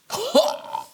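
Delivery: shrill cackle; high laugh. Acted.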